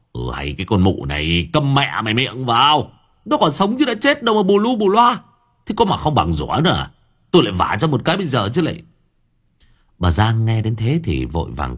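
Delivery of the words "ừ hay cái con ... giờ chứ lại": father snaps at his wife, rising high; scolding. A narrator's reading. Husband berates wailing wife, angry scolding. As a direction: shut her up, harsh and fast at the end. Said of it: "quay sang nạt nộ".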